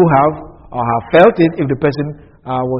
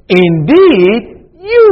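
"you" punched far higher and louder; stress on YOU; 7 semitones higher, 5 dB louder, much slower than usual. Shown as a demonstration. Preacher showing Joseph's stress on "you". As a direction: hit "you" hard, high and loud after a plain "Indeed". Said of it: "then you know where the emphasis is".

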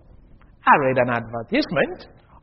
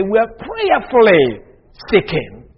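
mock pious; 5 semitones higher, 4 dB louder, slower than usual. Mock-pious re-quote of the advertisement.

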